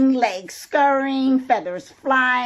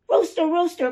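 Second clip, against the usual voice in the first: calling out; 6 semitones higher, faster than usual. A narrator's reading. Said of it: "called Hen".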